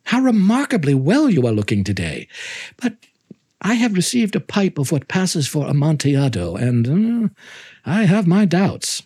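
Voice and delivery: high, gushing false friendliness; syrupy host greeting an old friend; Montresor's fawning greeting. Acted. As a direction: pour on the sweetness to flatter him. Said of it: "I said to him".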